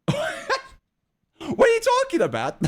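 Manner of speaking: high-pitched, laughing mock disbelief; retold flustered disbelief. Streamer retelling her reply to the fiance compliment, high flustered disbelief. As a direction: squeal it back as a flustered, amused protest.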